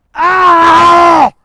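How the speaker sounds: long, high, full-force scream; anguished scream. Acted. Soldier tormented by the song in his head, screaming. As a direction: scream as if to drive it out of your head.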